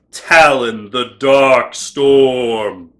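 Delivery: slow, loud, ominous name reveal; dramatic villain announcer. Pretend. Presenting the figure's shadow form, slow ominous announcer. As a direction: announce the dark form like a movie trailer.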